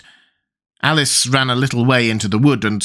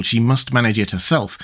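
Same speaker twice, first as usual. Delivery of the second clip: plain reading voice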